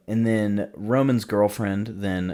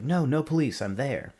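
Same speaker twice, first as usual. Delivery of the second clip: urgent protest; 7 semitones higher than usual. Retold or imitated. Panicked biker answers alarm operator.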